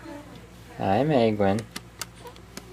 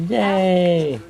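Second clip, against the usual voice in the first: excited cheer; 9 semitones higher than usual. Real, not acted. Cheering at baby.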